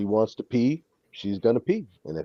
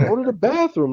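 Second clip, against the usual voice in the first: high, louder coaxing plea; pleading with the dog; 6 dB louder than usual. Retold or imitated. Owner in the cold begging the dog to pee, high coaxing.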